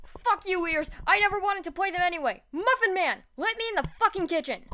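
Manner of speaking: high, fast, furious rant; fast, shouting tantrum; angry yell. Acted. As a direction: snap back in hot-tempered outrage. Bakugo rejected, yells insults.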